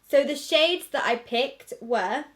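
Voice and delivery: ordinary talk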